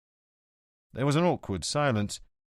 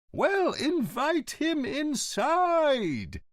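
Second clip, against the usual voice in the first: roaring; much slower than usual. Acted. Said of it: "The headmaster roared".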